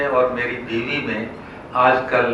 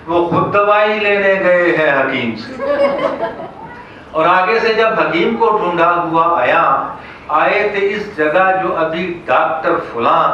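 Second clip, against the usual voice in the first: raised, rhythmic mushaira declamation; comic poem recital; 4 semitones higher, 4 dB louder than usual. Acted. Poet recounting a patient's hunt for doctors, declaimed. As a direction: declaim each rhymed line out to the hall.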